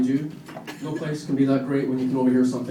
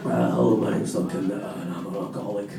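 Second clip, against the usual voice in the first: put-on low, vague Glasgow drunk; vague Glasgow accent; 8 semitones lower, 3 dB quieter than usual. Comic at an AA meeting in a vague Glasgow accent. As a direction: mumble it like a Glaswegian at AA. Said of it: "put on a sort of vaguely Glasgow accent".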